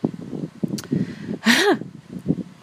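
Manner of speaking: breathy villainess's laugh; mocking villain laugh. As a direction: laugh in scorn at your victim. Enchantress mocks the prince.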